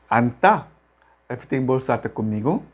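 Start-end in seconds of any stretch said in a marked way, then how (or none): 0.1-0.7 Anta with a hard, tense T on the final Ta; Anta, strong second syllable. Shown as a demonstration. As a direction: punch the Ta in Anta as a teacher's model. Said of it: "se pronuncia más fuerte como Ta". (Anta con la sílaba Ta más fuerte)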